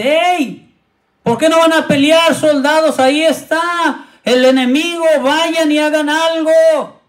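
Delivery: high, indignant call to the soldiers; angry rebuke. Retold or imitated. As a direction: challenge them, fed up with their waiting. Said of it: "se enoja y dice".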